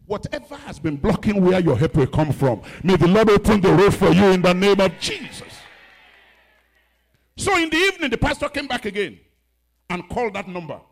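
loud declaration, high; preaching at full force. Acted. Pastor declaring a blessing on the church. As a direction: proclaim it over them. Prayer declaration over music.

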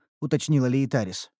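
usual speaking voice

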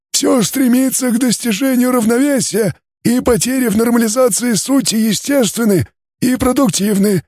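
high, strange alien timbre; a cool, detached alien scholar. Acted. Alien scientist continues. (высокий голос инопланетянина)

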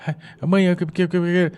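panting, stumbling over que que, pitch up; mock breathless stammer. Child rushing in out of breath, mock panting stammer. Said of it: "você chegava esbaforido".

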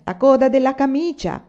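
loud, high parting retort; calling out. A narrator's reading. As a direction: call it up to someone at a window. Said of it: "dal cortile disse, rivolta alla finestra".

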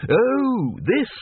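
high, airy mock surprise; feigned sudden noticing. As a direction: act as if you've only just spotted it. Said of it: "as if he'd only just at that moment noticed".

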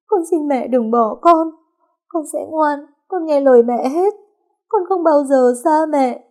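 weep and plead with the dead mother; sobbing. A narrator's reading. Nhiên crying over her mother's body, wailing. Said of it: "Nhiên bật khóc tu tu".

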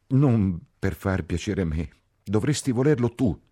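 high, loud, exasperated retort; upset reproach. A narrator's reading. Ferguson presses Catherine to want marriage, upset reproach. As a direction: push back, wounded and insistent.

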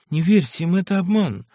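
ordinary delivery

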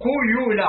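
قيول opening on qu, with a u vowel; damma on qaf. Shown as a demonstration. Reader cites al-Akhfash's variant قيول, with damma. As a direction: round the first vowel of قيول to u. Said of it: "بضم القاف والياء".

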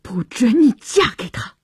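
seething, forceful prohibition; tight, angry command; through gritted teeth. A narrator's reading. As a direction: forbid it through clenched teeth. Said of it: "乔治咬牙切齿地说".

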